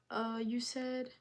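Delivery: ordinary delivery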